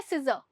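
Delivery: सीजर ending on a soft vowel with no r; no r sound. Shown as a demonstration. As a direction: say सीजर as see-zuh, the r dropped. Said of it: "आर की sound नहीं है". (सीजर बिना आर की आवाज़ के)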